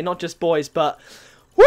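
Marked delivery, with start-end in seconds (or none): 1.5-1.6 high, loud woo into a laugh; celebratory whoop. Real, not acted. Player hyped after the crate win, high loud whoop. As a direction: whoop it out with real joy.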